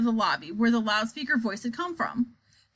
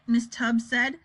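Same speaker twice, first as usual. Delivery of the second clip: ordinary delivery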